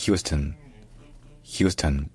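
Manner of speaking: "Houston" as HYOO-stun, first syllable stressed; English pronunciation. Shown as a demonstration. Teacher modelling the stressed first syllable, English pronunciation. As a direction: say "Houston" with a schwa ending. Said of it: "l'accent tonique est sur la première syllabe".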